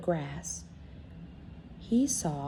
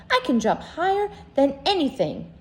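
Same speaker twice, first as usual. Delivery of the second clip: little rabbit boasting; 7 semitones higher, 4 dB louder than usual. Acted. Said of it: "said the little rabbit".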